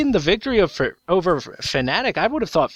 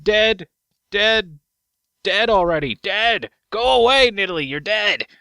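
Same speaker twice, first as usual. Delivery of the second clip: despairing Nidalee; 3 semitones higher, slower than usual. Pretend. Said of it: "Nidalee's like".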